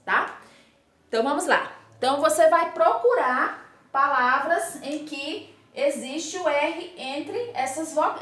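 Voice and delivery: ordinary talk, nothing marked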